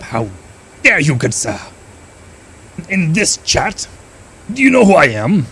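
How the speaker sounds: hammed-up haughty outrage; mock indignation. Scolding the chat in mock outrage. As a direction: play the offended gentleman, over the top.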